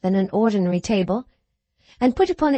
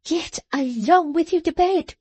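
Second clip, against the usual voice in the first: sharp, higher snap; snapped order, higher; snapping; 7 semitones higher, slower than usual. A narrator's reading. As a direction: cut him off. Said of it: "snapping him up".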